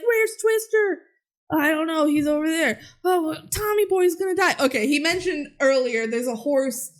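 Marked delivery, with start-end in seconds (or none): 0.0-4.5 lightly acted quote, voice pitched higher; mild hammed-up panic; worried cowboy. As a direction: voice the boy and the ranch hand, a bit higher. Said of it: "he's like".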